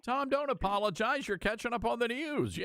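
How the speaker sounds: reassuring protest. Real, not acted. Host waves off a caller's apology for late news, reassuring.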